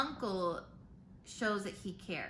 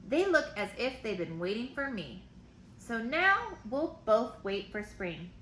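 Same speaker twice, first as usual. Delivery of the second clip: put-on lively read-aloud for kids; reading to children; 2 semitones higher than usual. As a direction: read the letter brightly to the class. Teacher reads letters aloud.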